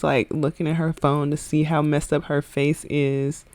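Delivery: usual speaking voice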